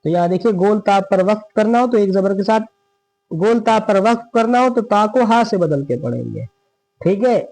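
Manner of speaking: ordinary talk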